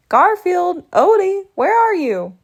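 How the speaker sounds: loud, high calling out; shouting for pets. Real, not acted. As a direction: yell across the yard for them.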